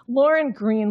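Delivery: usual speaking voice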